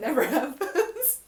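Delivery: amused laugh at own habit. Real, not acted. Laughs at never-ending loop.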